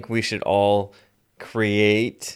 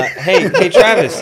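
high, loud call across the car; loud call for Travis; 10 semitones higher, 8 dB louder, slower than usual. Real, not acted. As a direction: shout his name to get his attention. Calling out to Travis.

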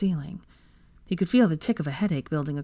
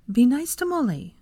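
higher-pitched gentle scolding; chiding; 7 semitones higher than usual. A narrator's reading. Hermione correcting Draco about their guest, chiding. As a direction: chide him lightly. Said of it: "Hermione chided him".

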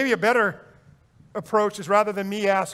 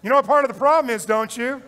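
higher, louder and fast; emphatic declaration; 3 semitones higher, 5 dB louder than usual. Real, not acted. Preacher hammering home the confession, loud and fast.

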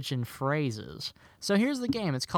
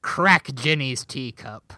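slow, loud pirate declaration; 7 dB louder than usual. Shown as a demonstration. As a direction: drag it out like a salty pirate. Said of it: "I'm gonna say it like a pirate".

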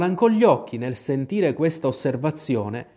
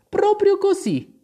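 very high, louder little reply; high-pitched storybook Alice; emphatic confirmation; 5 dB louder than usual. A narrator's reading. Narrator voices Alice confirming.

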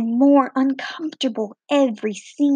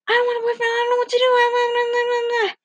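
much higher, frantic babble; panicked inner rambling; 10 semitones higher, faster than usual. Retold or imitated. Girl panicking inside after Bob asks her out, frantic high babble. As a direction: gush it out like a panicking teen. Panicked thoughts about a boyfriend.

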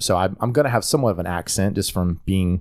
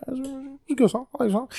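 mock first reaction; 5 dB quieter than usual. Host imitating a listener hearing the song, high and soft. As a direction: softly voice it in a high register. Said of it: "you're like".

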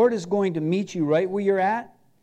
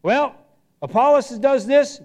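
boastful church members; 5 semitones higher, 6 dB louder than usual. Retold or imitated. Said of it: "What they were saying is".

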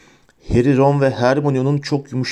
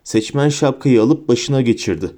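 plain reading voice

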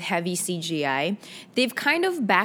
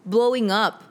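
ordinary delivery

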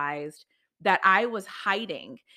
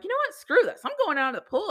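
sudden resolve; 10 semitones higher, faster than usual. Retold or imitated. Deciding to get out of the pool.

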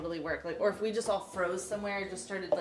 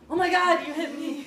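fake injured victim; 7 semitones higher, 7 dB louder than usual. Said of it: "was like".

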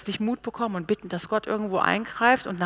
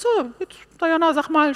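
lightly acted, higher-pitched summons; God giving an order; 10 semitones higher, 4 dB louder than usual. God calls on a hesitant believer, lightly acted summons. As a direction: call her by name with a lifted voice.

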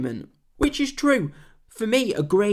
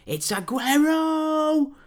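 drawn-out, much higher commentator scream; Martin Tyler goal call; 12 semitones higher, much slower than usual. Retold or imitated. Football fan recalling the famous title-winning call, slow high scream. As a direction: stretch the name out like a last-minute winner. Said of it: "remembers Martin Tyler's commentary on this match".

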